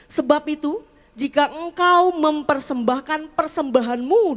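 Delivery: loud, emphatic Bible reading; scripture reading. Real, not acted. Preacher reading Matthew to the congregation, loud and emphatic. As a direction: read it out solemnly, leaning on key words. Saya baca buat Bapak Ibu.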